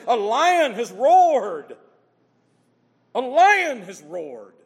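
slow, heavy, booming proclamation. Acted. Preacher proclaiming Amos's lion warning, slow and booming. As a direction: let every word land like a roar.

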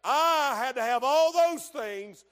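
sharp, loud denial; a preacher's emphatic "No!"; shouted no. Acted. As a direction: slam the answer down hard. Preacher answers his own question.

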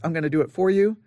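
normal talking voice

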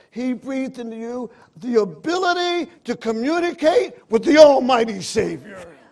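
full-force preaching. Acted. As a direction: preach it out with building fire.

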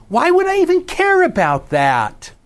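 dramatic high-pitched rhetorical why; big pitch swing. Shown as a demonstration. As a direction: leap way up on the question. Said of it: "you notice I just did it".

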